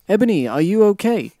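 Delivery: hammed-up worried friend; mock concerned question. A narrator's reading. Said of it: "asked in a concerted voice".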